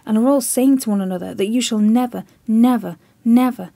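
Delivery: loud, desperate pleading that keeps climbing; frantic plea. Acted. Chips frantically warning the admiral, loud desperate plea. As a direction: shout the warning, pleading for his life.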